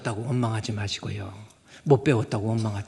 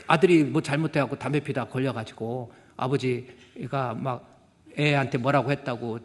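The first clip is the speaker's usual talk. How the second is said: ordinary talk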